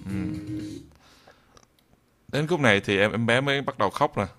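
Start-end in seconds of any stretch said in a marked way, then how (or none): none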